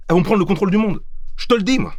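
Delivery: alarmed warning. Acted. Paranoid friend warns about AI.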